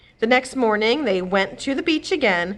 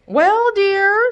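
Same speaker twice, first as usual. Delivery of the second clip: much higher, louder soothing husband; 13 semitones higher, 7 dB louder than usual. Acted. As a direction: soothe the grumbling wife. Husband voiced.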